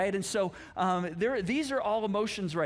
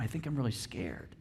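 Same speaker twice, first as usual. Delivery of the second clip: hushed, scared whisper; scared confession; 7 semitones lower, 6 dB quieter than usual. Acted. Pastor voicing a churchgoer who admits being scared, in a hushed whisper. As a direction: breathe it out like a secret fear.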